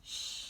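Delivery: sh, a quiet hush of air; sh sound. Shown as a demonstration. Teacher repeating the digraph for kids, quiet sh. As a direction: model the sh sound softly. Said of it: "S H sounds like".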